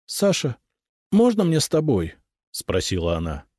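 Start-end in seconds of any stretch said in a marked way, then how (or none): 0.0-2.1 high, imploring request; pleading. A narrator's reading. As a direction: ask to come along, pleading in a raised pitch. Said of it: "умоляюще посмотрела на меня". (умоляюще, высоким голосом)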